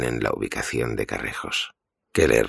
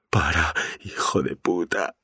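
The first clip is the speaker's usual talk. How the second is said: pained curse; 5 semitones higher than usual. Acted. Mikey begging Keller to stop.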